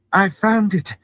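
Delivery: high-pitched, thrilled cry; shouting with the thrill of discovery; excited shout. A narrator's reading. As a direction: call it out in excitement. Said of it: "Elaine shouted excitedly".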